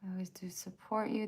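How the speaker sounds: quick soft whisper. Real, not acted. Streamer whispering asides to the chat. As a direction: mutter it under your breath.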